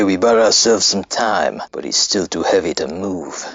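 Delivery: ordinary talk, nothing marked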